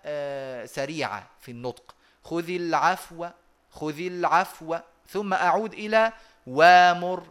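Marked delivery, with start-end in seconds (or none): 2.2-4.8 خذ العفو slowed, sliding into a brief hushed waw; quick soft waw. Shown as a demonstration. Teacher drilling the short waw for students. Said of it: "وأتيت بواو بصوت منخفض سريعة".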